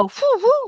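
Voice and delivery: high whistling whoo, whoo; imitated whistle. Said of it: "that kind of whistle where people go".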